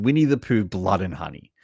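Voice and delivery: ordinary talk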